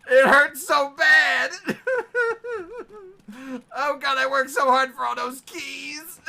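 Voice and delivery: high wailing laugh through tears; laughing and crying at once. Real, not acted. Laughing over lost keys.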